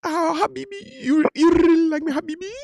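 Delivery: high, hammed-up girl's voice; girlish voice imitation. Man imitating a girl he talked to in a high voice. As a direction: squeak it like the girl did. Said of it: "that was exactly how she talked like".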